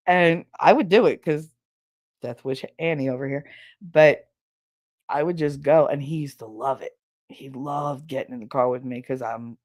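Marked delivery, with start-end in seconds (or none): none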